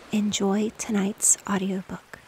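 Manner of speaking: ordinary delivery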